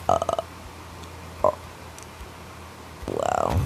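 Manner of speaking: low, halting mock astonishment; stunned parents. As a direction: play parents lost for words, with long pauses. Stunned parents voiced in story.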